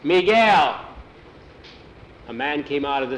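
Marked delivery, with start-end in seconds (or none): 0.0-0.8 narrated shout across to someone, building on the name; calling yell. A narrator's reading. Driver hailing a man at the stable, calling yell. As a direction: call out, raised on the name. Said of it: "the man yelled".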